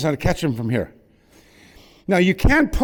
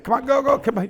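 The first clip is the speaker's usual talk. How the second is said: urging; 8 semitones higher than usual. Real, not acted. Urging student during drill.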